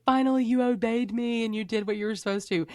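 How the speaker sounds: a relieved, teasing Lord; voicing the Lord. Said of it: "He's like".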